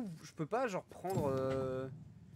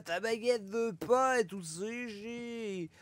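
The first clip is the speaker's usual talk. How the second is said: slow, higher put-on French accent; Quebecois doing French accent; 5 semitones higher, much slower than usual. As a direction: drag out a hammy French accent. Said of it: "j'imite un Québécois qui imite la- l'accent français".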